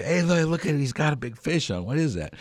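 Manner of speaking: high, amazed bystander calling out; excited yelling. Retold or imitated. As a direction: point and call to the crowd, thrilled. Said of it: "they're pointing and yelling and say".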